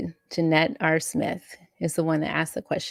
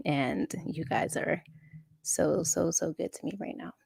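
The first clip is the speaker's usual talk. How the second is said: much softer, whispered with emotion; whispered thanks; 8 dB quieter than usual. Real, not acted. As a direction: whisper your gratitude to them. Touched thanks to viewers.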